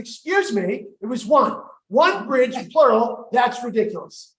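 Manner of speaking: comically offended objection; indignant retort. Retold or imitated. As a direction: protest it loudly, punching each correction. Teller retorts to the cousin's accusation.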